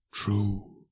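hushed spirit voice; quiet ghost. Acted. Ghost agrees with Scrooge, hushed spirit voice. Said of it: "said the ghost".

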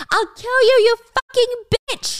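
high, loud furious scream; screaming threat. Acted. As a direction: scream it through the locked door. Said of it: "Danny's screaming".

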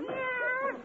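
high drawled Western meow; long twangy Western Meow. Shown as a demonstration. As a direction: stretch Meow out like a cowhand. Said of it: "stretch it out with a little Western drawl".

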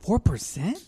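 high-pitched surprised question; startled double-take; real surprise. Real, not acted. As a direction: blurt it out in disbelief, pitch jumping up. Surprised at the beer's strength.

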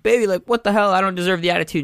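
mock indignant whine; exasperated quote. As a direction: play up being wronged. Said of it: "'cause maybe I'm like".

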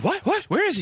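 sudden jump up high, frantic; panicked mom. Retold or imitated. A mother realizing her husband is missing, panicked. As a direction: mom startled, asking where dad went. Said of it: "She panics".

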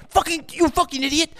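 high-pitched mock scolding of a kid; imitated dad telling off his child.

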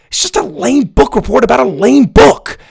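put-on a kid snapping back defensively; shooting back. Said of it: "Ben shot back".

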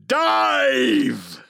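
drawn-out theatrical shout; battle command. Acted. Hawk man ordering an attack, hammed-up shout. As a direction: bellow it to the troops.

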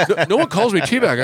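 high, flustered mock protest; mad and flustered. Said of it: "he got all mad and flustered".

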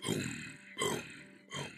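short low booms, spaced apart; boom, pause, boom; imitated booms. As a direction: voice the dull thud of a shaking stadium. Imitates the stadium shaking.

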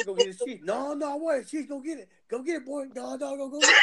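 boisterous laughing shouts; playful shouting. Retold or imitated. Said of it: "Daniel tried to push me back".

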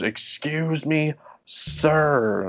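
high, slow, drawn-out excuse me; hammed-up entitled guest; mock pushy lady. Lady guest demanding the lifeguard's attention, mock prissy.

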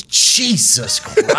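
slow, high, stunned imitation of dad; a father seeing a giant opponent, drawn-out awe; dad's shocked gasp. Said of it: "my dad goes".

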